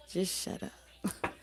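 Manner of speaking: slow whispered hush. Retold or imitated. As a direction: drag it out in a whisper. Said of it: "You're like".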